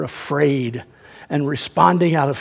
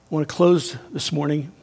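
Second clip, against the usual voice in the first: usual speaking voice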